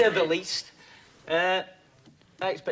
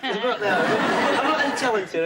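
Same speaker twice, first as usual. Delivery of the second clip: laughing; 8 semitones higher, 6 dB louder, slower than usual. Real, not acted. Self-deprecating with a laugh.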